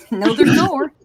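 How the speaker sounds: slow, laughing through the words. Real, not acted. Retelling her brother's reply to friends, laughing hard. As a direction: crack up while saying it.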